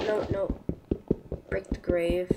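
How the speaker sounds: hushed, unvoiced whisper. Real, not acted. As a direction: whisper so nothing hears you. Sneaking near grave.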